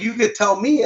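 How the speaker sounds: high, incredulous comeback; frustrated you-could-have-told-me; exasperated retort. Retold or imitated. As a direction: fling it back, pitched high. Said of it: "I'm like".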